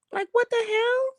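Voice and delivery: high, stunned what-the-hell; outraged disbelief. Real, not acted. Complaining to viewers about the overcharge, high and stunned. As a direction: squeak of disbelief.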